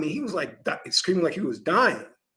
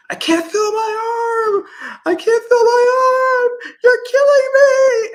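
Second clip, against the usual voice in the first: put-on high, slow, wailing child's cry, much higher and louder; screaming child; 9 dB louder, slower than usual. Speaker mimics a hospital child screaming in pain. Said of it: "this kid was yelling like crazy".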